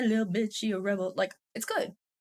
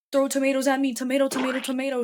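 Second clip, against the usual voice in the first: ordinary talk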